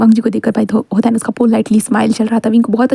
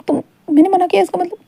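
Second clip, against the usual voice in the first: 1 indignant high; 8 semitones higher than usual. Acted. Wing indignant.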